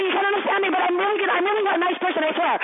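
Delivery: high, rushed, over-eager pleading; mock overcompensating apology. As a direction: gush apologies to parody someone trying too hard. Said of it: "You're the overcompensator".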